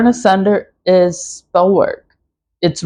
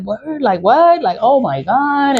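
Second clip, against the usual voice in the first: fake surprise; 6 semitones higher than usual. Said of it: "And I'm gonna be like".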